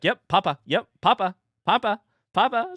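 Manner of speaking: mock-eager parent prompting; eager encouragement. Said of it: "I latched onto that immediately".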